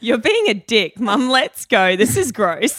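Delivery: loud, blunt re-enacted telling-off; loud acted-out confrontation; retold outburst. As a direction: call it out loudly, replaying the row. Acting out her outburst at the table.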